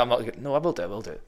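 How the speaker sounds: ordinary talk, nothing marked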